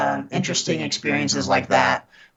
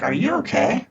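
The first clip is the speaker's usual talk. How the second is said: grandma voice; 10 semitones higher than usual. Retold or imitated. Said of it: "in my grandma voice".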